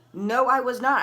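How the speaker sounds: acting out an outraged denial; indignant denial. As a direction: deny it hotly, voice jumping up. Acted protest to the officer.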